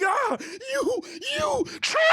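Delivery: high, strained cry of betrayal; hammed-up anguished shriek; mock tearful scream. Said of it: "Eren starts crying".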